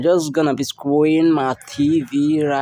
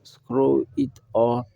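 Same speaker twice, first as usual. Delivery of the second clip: ordinary talk, nothing marked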